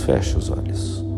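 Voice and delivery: soft whispered command. Acted. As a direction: breathe the words out gently. Whispered eye-closing cue.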